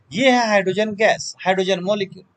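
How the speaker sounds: ordinary talk, nothing marked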